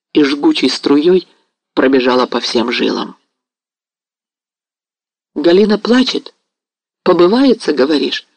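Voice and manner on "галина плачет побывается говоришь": abrupt. A narrator's reading. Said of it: "проговорил Мазепа отрывисто".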